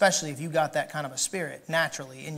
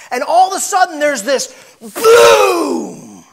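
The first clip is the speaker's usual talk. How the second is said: shouted boom; 10 semitones higher, 13 dB louder, slower than usual. Acted. Preacher explodes to show anger bursting out, shouting. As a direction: shout boom, much higher and louder.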